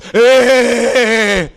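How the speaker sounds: long, loud wailing noise; mock revival cry, drawn out; coming back to life. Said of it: "come back to life like this".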